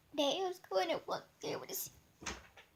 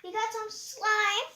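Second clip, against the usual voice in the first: high, slow announcing; 9 semitones higher, slower than usual. Real, not acted.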